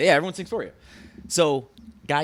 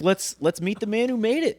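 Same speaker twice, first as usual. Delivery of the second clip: higher showman voice; mock host intro; 9 semitones higher than usual. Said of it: "He's like".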